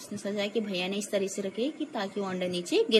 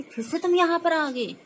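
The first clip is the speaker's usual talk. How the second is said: high-pitched, acted scolding question; scolding mother; 9 semitones higher, 4 dB louder than usual. Mother catches the children outside again, high acted scolding. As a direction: demand it as a cross mother.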